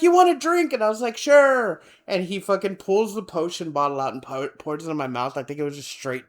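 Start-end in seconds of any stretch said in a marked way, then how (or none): 0.0-0.7 high, loud mock voice of the guy; mock offer. Party guy offering me a drink, high and loud. 1.2-1.8 high, loud eager yes. Retold or imitated. Me accepting the potion drink, high and eager.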